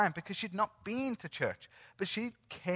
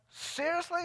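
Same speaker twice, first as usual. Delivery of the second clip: high-pitched, drawn-in astonishment; retold disbelief; 11 semitones higher, 4 dB quieter than usual. Visitor hearing her say yes to Jesus, amazed. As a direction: ask it high, as if you can hardly believe it. Teller recalls own amazed reply.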